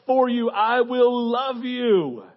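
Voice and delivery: loud, high, declaring voice; impassioned call building to a drawn-out close; commanding loving shepherd. Acted. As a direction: proclaim it as the protecting Lord. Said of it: "He's like".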